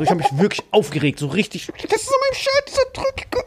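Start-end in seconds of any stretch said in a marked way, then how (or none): 1.9-3.4 very high squeaky outrage; shrieking in disbelief, played up; mock outrage. Retold outrage at being grabbed. (sehr hohe gespielte Empörung)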